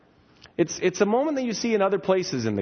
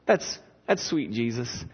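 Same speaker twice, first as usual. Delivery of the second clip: patronizing Martha; 3 semitones higher, 4 dB quieter than usual. Retold or imitated. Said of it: "And she's like".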